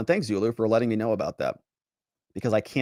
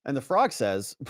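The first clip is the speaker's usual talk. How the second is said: ordinary delivery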